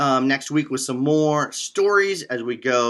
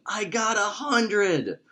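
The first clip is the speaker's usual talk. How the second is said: mild mock yell; 7 semitones higher, much slower than usual. A narrator's reading. Boy runs into school with his test score, mock yell. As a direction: stretch it out high, like yelling without the volume. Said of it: "He ran into the school yelling".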